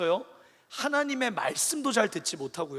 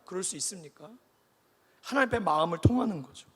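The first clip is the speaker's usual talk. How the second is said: ordinary talk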